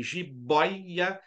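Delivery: JIBOIA slowly split, BOI held; diphthong example. Shown as a demonstration. Teacher listing examples, "jiboia" slowly syllabified on BOI. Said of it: "O E começa alto e cai pro I".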